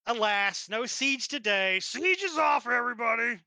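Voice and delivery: drawn-out call, rising high then dropping away; mock announcement. Game master telling players the siege is cancelled, mock town-crier call. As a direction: call it out slowly, then tail off.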